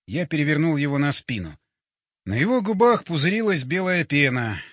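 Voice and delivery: ordinary delivery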